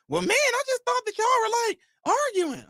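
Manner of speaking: high falsetto wife's voice, puzzled; imitating his wife. Husband mimics his wife checking on the noise, in high falsetto. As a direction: squeak it out like a worried wife. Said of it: "wife's reply retold".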